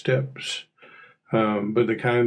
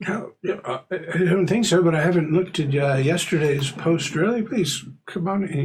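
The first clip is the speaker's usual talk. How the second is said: higher-pitched, halting, courteous neighbor; Mister Corbett; 6 semitones higher than usual. Acted. Neighbor asked about missing mail invites her in, high-pitched and halting. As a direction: stumble politely through it in a raised voice.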